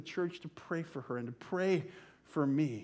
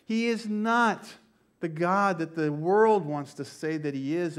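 normal talking voice

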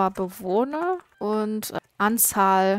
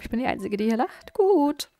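normal talking voice

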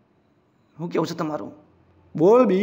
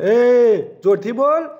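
demanding shout; 3 semitones higher, 7 dB louder, much slower than usual. Acted. Bully demands an answer.

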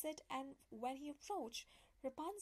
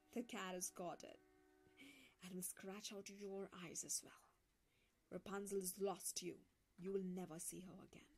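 lower, taunting voice sinking to a whisper; mocking; 5 semitones lower than usual. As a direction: gloat over his loss, half whispered. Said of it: "she cried mockingly".

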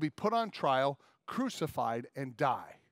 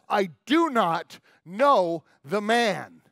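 loud, very slow, word-by-word acted denial; swearing angry denial; 3 semitones higher, 9 dB louder, much slower than usual. Peter swearing at the child who accuses him, acted angry denial. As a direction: hammer out each word loudly and slowly. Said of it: "Peter swears at the child".

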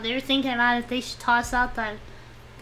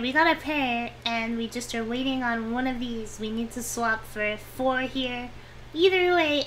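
ordinary talk, nothing marked

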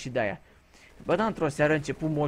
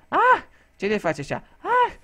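loud, high startled shout; shocked nurse yelling; 7 dB louder than usual. Retold or imitated. Nurse shouts at the patient who hurt her, high and loud. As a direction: yelp it out, alarmed and much higher. Nurse yells at the old man.

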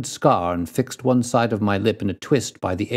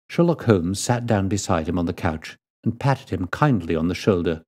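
ordinary delivery

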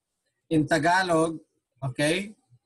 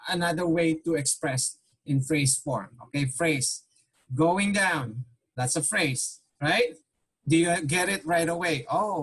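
usual speaking voice

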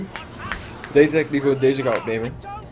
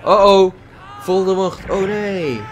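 startled; 6 semitones higher, 6 dB louder, much slower than usual. Real, not acted. Startled in game.

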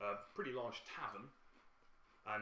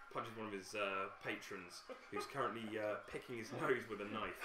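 ordinary delivery

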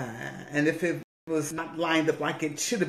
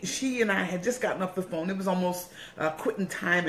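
usual speaking voice

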